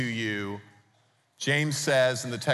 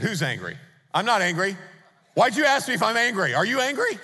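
rising, indignant protest, louder and higher; defensive denial; 9 semitones higher, 5 dB louder than usual. Retold or imitated. Retelling his counselor session, a mock-indignant denial climbing higher. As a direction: deny it too fast, getting more worked up each line.

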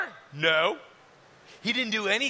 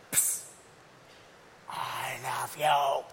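whisper; 9 semitones lower, 3 dB quieter, slower than usual. Acted. God whispers to Jesus.

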